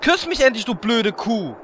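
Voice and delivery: yelling at the priestess in a quarrel; furious shout. Acted. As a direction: hurl the insult at her. Heated quarrel peaks.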